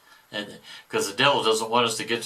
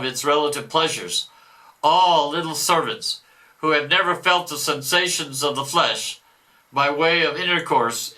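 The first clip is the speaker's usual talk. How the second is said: a boastful devil, louder than the commentary; 6 semitones higher, 5 dB louder, slower than usual. Acted. Devil boasting of stolen souls, mock devil voice. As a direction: read it as the gloating devil. Reader voicing the devil's message.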